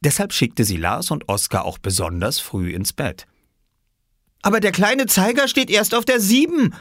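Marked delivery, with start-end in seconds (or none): 4.4-6.7 put-on high, indignant child protest; indignant cry. A narrator's reading. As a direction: protest like a wronged little boy. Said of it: "rief Oskar empört".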